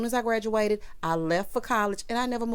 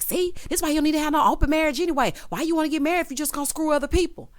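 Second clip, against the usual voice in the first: mama going off; 8 semitones higher, faster than usual. Retold or imitated. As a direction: rattle it off like a fussing mother caught up in her rant. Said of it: "Mama going off".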